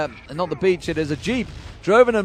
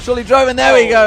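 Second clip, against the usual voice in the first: excited; 5 semitones higher, 8 dB louder than usual. Real, not acted. Cheering a kill.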